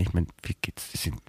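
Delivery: muttering to himself. Acted. Grumbling to himself, alone.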